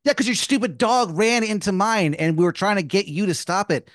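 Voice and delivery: heated retort. Acted. Blaming the neighbor's dog, heated retort. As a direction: argue back in one indignant rush.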